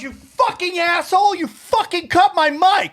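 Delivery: Sarah screaming at the host; retold screaming. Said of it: "She starts screaming at me on stage".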